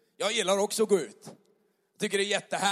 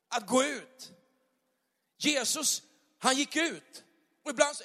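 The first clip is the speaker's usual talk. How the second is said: preaching fervour, pitch up; rising preaching; 3 semitones higher than usual. Acted. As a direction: lift the congregation, building. Predikar med kraft. (predikan med stigande glöd)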